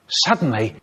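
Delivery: ordinary talk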